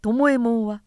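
ordinary delivery, nothing marked

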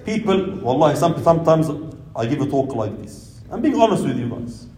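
usual speaking voice

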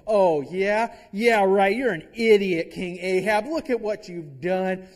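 loud, scornful comeback; sarcastic insult. Pretend. Elijah firing a loud scornful insult back at Ahab. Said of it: "he could have had the tendency to go".

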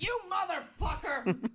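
very high-pitched hammed-up outrage; mock inner scream. Player's secret reply to his coach, mock high-pitched scream. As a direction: squeal the curse in mock fury. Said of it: "Internally, I'm like".

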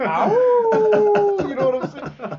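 high, loud hyped radio DJ; whooping wasteland radio host; Three Dog radio DJ. Pretend. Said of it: "I'm trying to be like Three Dog".

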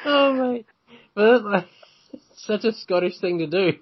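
high, hammed-up Scottish accent. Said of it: "my horrendous Scottish accent".

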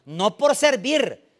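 loud, slow, every word hammered; preaching climax. Acted. Pastor proclaims to his congregation, loud and slow. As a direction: drive it home to the congregation.

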